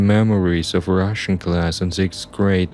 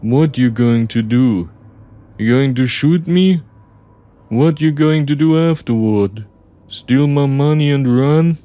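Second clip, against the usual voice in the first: higher, louder mocking questions; taunting; 7 semitones higher, 5 dB louder than usual. Driver mocks the narrator's threat, taunting. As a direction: goad with each question.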